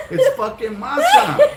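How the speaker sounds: high, drawn-out laughing masa; laughing disbelief. Retold or imitated. Telling friends the punchline, high slow laughing. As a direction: squeal it out, amused.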